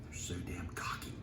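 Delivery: soft whispered grumble; inner thought. A narrator's reading. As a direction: mutter to himself under breath. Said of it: "thought Walter Mitty".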